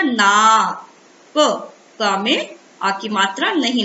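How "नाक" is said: नाक loud, with a long held aa; drawn-out vowel. Shown as a demonstration. Teacher showing children how नाक sounds, drawn-out vowel. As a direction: stretch the naa in नाक. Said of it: "इसका उच्चारण क्या हो जाएगा".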